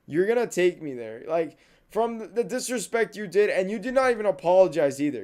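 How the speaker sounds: high, loud tirade at a friend; indignant demand. Acted. Demanding a ride from a friend who never apologized, indignant.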